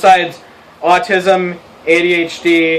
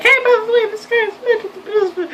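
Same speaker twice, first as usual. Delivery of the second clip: much higher, mocking whiny voice; mocking tattletale. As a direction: squeal it like a snitch. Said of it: "not going to be like".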